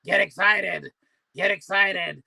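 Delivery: a light hint of a raspy motivator; raspy guy impression. Vlogger imitating a raspy YouTuber's catchphrase, lightly raspy. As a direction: mildly rasp out the catchphrase. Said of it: "He's got a real raspy voice".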